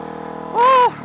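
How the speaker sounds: high, slow, loud flustered whine; timid flustered person. Retold or imitated. Mocking timid people who fear the president, high slow whine. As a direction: fret like a frightened old worrier. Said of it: "They're like".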